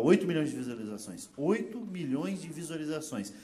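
ordinary talk

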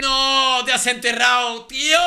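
high, loud, drawn-out cry of disbelief; loud, high-pitched wail of dismay; exasperated outcry. Real, not acted. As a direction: cry out as if a sure win just collapsed. Exasperated outburst at opponent's blunder.

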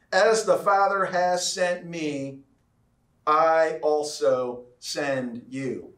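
slow, weighty scripture quote; solemn, deliberate quote. Acted. Preacher quotes Jesus sending his disciples, slow and deliberate. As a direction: spell out the commission slowly, word by word.

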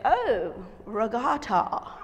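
slow, drawn-out mock-pitying condescension; mock upper-crust drawl; snooty boarding-school boy. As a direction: imitate a condescending prep-school boy, lingering on words. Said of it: "a young man from a private boarding school".

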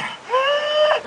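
sharp high gasp, sucking in air; demo gasp. Shown as a demonstration. Street performer demonstrates the crowd's gasp, a sharp high gasp. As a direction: show the crowd the gasp they'll make. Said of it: "everybody here is gonna go like this".

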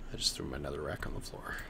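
quick whisper under the breath; whispered aside. Real, not acted. Aside, fast and unvoiced.

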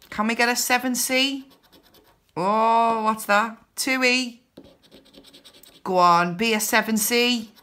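normal talking voice

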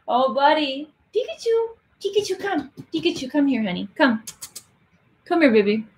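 high sing-song coaxing of a pet, lilting and tender; calling the cat. Real, not acted. Streamer coaxing her cat Pikachu to come to the camera, high coaxing pet talk.

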